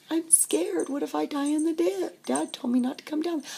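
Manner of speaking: high-pitched mock pleading; scared child. Girl to brother at the dip, scared pleading. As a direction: plead like a worried little kid.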